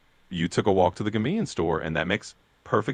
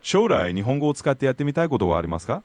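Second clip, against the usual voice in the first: fast native Japanese question; 5 semitones higher, faster than usual. Acted. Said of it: "I'll repeat it once more".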